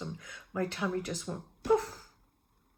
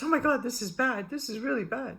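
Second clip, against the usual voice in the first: raised, louder alarmed outburst; a touch of mock alarm, higher and louder; alarmed doctor; 5 semitones higher, 5 dB louder than usual. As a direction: play the doctor panicking over bad news. Doctor alarmed at x-ray results.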